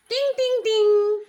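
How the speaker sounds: high, sustained ting-ting-ting; imitated bell. Teacher voices the ice-cream cart bell for children, imitated ringing. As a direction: ring it out like a cart bell, held on the last.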